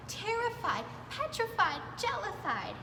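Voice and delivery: plain reading voice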